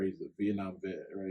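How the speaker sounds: normal talking voice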